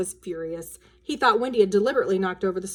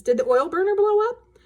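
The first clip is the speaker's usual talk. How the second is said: high, louder alarmed question; startled father; 11 semitones higher, 6 dB louder than usual. A narrator's reading. Father sees smoke on ceiling, startled. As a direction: sound shocked at the ceiling.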